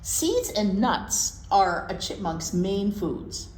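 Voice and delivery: ordinary talk, nothing marked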